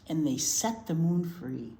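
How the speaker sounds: ordinary delivery, nothing marked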